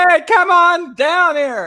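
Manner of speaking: slow, high mock call up the stairs; imitating a yell. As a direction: call her husband down like she does. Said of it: "usually you just yell".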